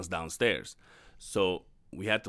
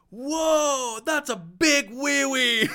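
put-on much higher, a slow awed exclamation from a child; imitating a kid's wide-eyed astonishment; amazed little kid; 7 dB louder, much slower than usual. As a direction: gasp it out like a small boy in wonder. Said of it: "all I remember him saying is".

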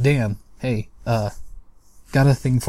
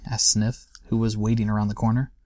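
plain reading voice

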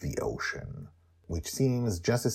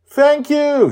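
high, loud excited shout; 15 dB louder than usual. Acted. Joy shouting to Charlie from the condo, high and loud. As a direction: call out from the flying condo. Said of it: "she shouts towards the ocean".

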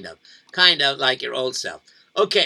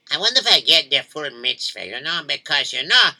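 ordinary delivery

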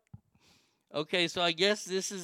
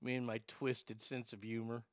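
much softer and lower, a guilty mumble; sheepish aside; 7 semitones lower, 13 dB quieter than usual. Real, not acted. Host owning up to his twisted humor, softly sheepish. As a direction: mutter it like a confession to yourself. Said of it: "I know I'm going to hell".

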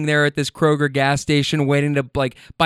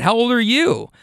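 higher-pitched echo of her question; retorting question; 7 semitones higher than usual. Retold or imitated. Driver answers the woman with her own question, higher retort. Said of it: "And I said".

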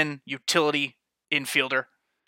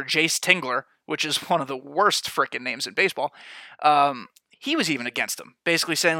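ordinary talk, nothing marked

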